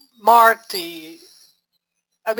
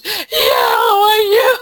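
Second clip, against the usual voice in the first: much higher, louder, slow gushing hello; fake sweet greeting; 12 semitones higher, 7 dB louder, slower than usual. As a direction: gush over the phone like a phony. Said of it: "how inauthentic that is".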